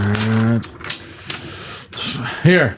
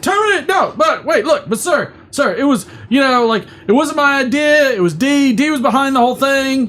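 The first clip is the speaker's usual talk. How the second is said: much higher, frantic excuses; panicked pleading; 13 semitones higher than usual. Acted. Employee blaming his friend to the boss, panicked pleading. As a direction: plead for your job, hammed up.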